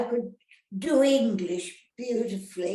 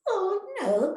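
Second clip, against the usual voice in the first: high-pitched mock innocence, retelling her younger self; innocent oh no; 10 semitones higher than usual. Retelling her younger self's denial to the interviewer, with high-pitched mock innocence. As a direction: squeak it out like a child caught out. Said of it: "I said".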